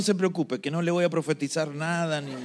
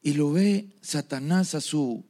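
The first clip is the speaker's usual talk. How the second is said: ordinary talk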